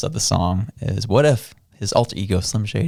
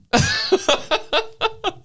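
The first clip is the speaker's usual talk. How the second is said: high, bright laugh burst; excited laugh. Real, not acted. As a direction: laugh with delight. Laughs about [name].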